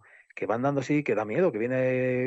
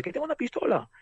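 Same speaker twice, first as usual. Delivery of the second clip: high-pitched imitation of a stranger threatening; mock threat; 11 semitones higher than usual. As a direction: mimic the would-be gunman, voice way up. Retold stranger claims a gun. (amenaza imitada, con voz aguda)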